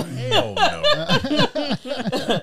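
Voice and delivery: high laughing hell no; husband laughing at running a half marathon; laughing refusal. Real, not acted. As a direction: burst out laughing at the idea.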